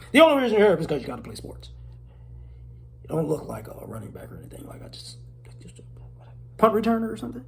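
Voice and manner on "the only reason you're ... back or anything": ordinary delivery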